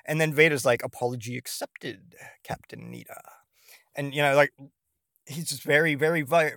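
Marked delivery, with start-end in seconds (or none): 0.8-3.2 very soft, low, menacing calm; a quiet threat, barely voiced; subtle Vader threat. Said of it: "very subtle".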